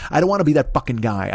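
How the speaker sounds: ordinary talk, nothing marked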